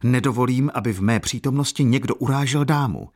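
put-on young man flares up at the count, indignant; flaring up. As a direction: defend the lady's honour hotly. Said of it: "vzplanul mladík".

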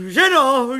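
loud high call to the wife. A narrator's reading. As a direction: call her in. Calling wife.

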